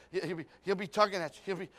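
ordinary delivery

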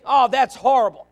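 loud, sharp imitation of a shocked listener; exaggerated gasp of disapproval; mock shock. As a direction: play up the outrage. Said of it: "See, you may think".

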